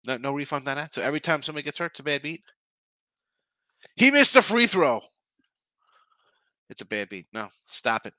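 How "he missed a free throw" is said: high, loud whiny excuse; hammed-up crybaby bettor; mock whine.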